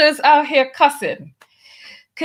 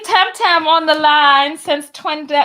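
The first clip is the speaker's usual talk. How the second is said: ordinary talk, nothing marked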